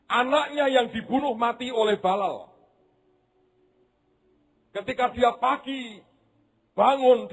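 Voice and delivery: high, urgent preacher's storytelling; fired-up sermon voice; fervent preaching. Acted. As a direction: preach the tale to the crowd. Preacher storytelling at full force.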